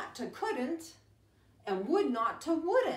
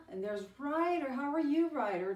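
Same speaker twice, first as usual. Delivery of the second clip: ordinary delivery, nothing marked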